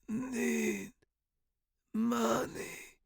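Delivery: soft, high strained rasp; choked croak. A narrator's reading. Dying professor to student, choked. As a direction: croak each word with failing breath. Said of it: "he choked out".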